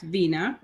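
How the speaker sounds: Vina said VEE-nah; Vina rhyming with Tina; correct name pronunciation. Shown as a demonstration. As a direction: confirm Vina with a long ee in the first syllable. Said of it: "No, you were correct".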